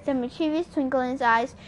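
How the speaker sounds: ordinary delivery, nothing marked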